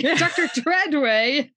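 says the name through a laugh, slowly. Real, not acted. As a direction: laugh out the name, stretched and rising. Laughing while naming the character.

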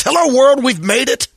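high, bright call to the whole city; a radio host's big excited morning greeting; cheery greeting. Acted. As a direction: belt out a big morning hello. Cheery morning report opening.

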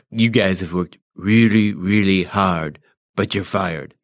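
low, slow, nose held shut; nose pinched. Shown as a demonstration. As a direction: Trump try with nose closed. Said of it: "close your nose off".

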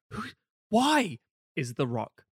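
slow, dumbfounded mock voice; baffled moviegoer. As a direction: drag it out like a confused viewer. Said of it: "And everyone goes".

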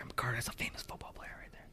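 very soft, fast whisper; retold hushed, hurried aside; urgent whisper. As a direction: confide it quickly to your kid. Secretly telling son about celebrity.

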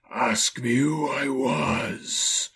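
very slow, drawn-out spectral voice; eerie, slow ghost voice; Marley's ghost. A narrator's reading. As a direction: stretch each word like a haunting spirit. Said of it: "Marley's voice, no doubt about it".

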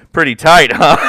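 loud and pleased with herself; mock flirty patient. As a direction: fish for a compliment, loudly. Patient fishing for compliments.

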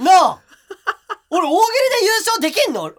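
high, loud, laughing through the words; laughing in disbelief. Real, not acted. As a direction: laugh at your own unlikely win. Recalling his comedy contest win.

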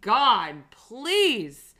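high, loud, drawn-out begging; exasperated plea. Real, not acted. Podcaster begging the bookers to stop pushing Candice, exasperated. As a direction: beg with long pauses between the words. Pleading rant about Candice's push.

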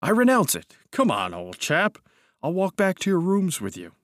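high, lilting coaxing; placating. A narrator's reading. Cousin leading narrator home, placating. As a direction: humour him and coax him along.

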